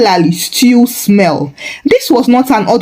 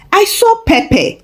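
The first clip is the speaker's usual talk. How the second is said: put-on boy's voice; 11 semitones higher than usual. A narrator's reading. Boy reports innocently.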